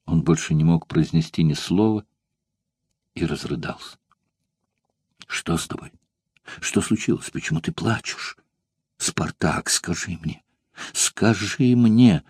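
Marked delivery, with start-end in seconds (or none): 3.2-4.0 softer, tearful narration; a narrator's voice welling up. A narrator's reading. 5.3-8.3 frightened, breathless whisper; a lover whispering in fear over his tears; anxious whisper. A narrator's reading. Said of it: "Прерывающимся от тревоги голосом". 9.0-12.2 quiet pleading, then a slow drawn-out plea; a frightened woman begging her lover to speak; anxious pleading. A narrator's reading. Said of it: "Прерывающимся от тревоги голосом повторяла".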